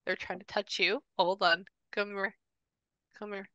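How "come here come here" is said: soft coaxing. Real, not acted. Medium calling spirit closer softly.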